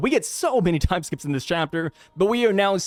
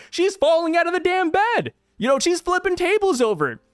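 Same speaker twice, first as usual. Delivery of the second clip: hyped up; 11 semitones higher than usual. Real, not acted. Hyped retelling of her panic.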